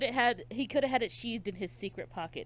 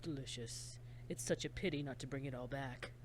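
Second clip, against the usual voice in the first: hushed and savoring every word, much softer; a killer murmuring to himself over the body; creepy soft killer; 6 semitones lower, 10 dB quieter than usual. Acted. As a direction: relish it like a quiet psychopath. S1 voicing the killer.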